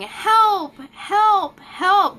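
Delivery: high, loud mock cries for help; toddler screaming. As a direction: yell help like a frantic toddler. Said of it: "And he was screaming".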